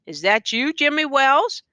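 a mild cry of surprise. A narrator's reading. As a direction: call out his old friend's name. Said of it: "cried the man in the door".

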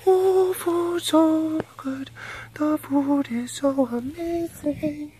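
a starving cat dreaming of dinner; high squeaky food-crazed cat; voicing the hungry cat. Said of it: "pretty anxious about just like".

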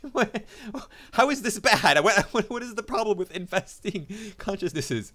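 high, laughing disbelief; incredulous laughing. Real, not acted. Host ridiculing a claim about infesting consciousnesses, incredulous laughing. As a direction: splutter it through real laughter, high and loud. Incredulous reaction to text being read.

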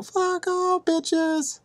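a held-back high-pitched yell; mock battle cry. Proposing a better Spartan line, in soft high falsetto. His suggested replacement movie line.

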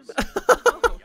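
squeaky unvoiced giggle; high breathy laugh. Real, not acted. Gamer cracking up at the Sims romance, squeaky laugh. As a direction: break into a high airless giggle.

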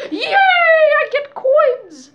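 high happy squeal, stretched out; cheer, slow and delighted; excited cheer. Real, not acted. Cheering over coins.

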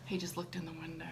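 whispered aside. Real, not acted. Mother whispering to viewers about her son, hushed. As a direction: whisper it so he can't hear.

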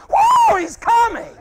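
high, loud jubilant shout; excited whoop. Real, not acted. Preacher rejoicing to church that He's coming, excited shout. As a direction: whoop it out with joy.

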